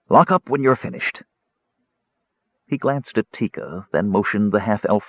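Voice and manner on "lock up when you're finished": ordinary delivery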